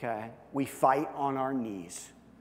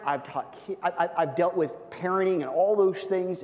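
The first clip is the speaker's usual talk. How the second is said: normal talking voice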